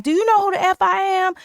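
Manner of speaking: high, indignant mock O-Dog challenge; hammed-up threatening demand; angry retort. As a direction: fire it back like a wounded ego. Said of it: "now he's angry and he's like".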